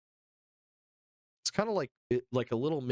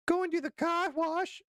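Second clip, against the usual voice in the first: put-on high, drawn-out Boston accent call; hammed-up Boston accent, pitched way up; slower than usual. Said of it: "Boston accents are toddler accents".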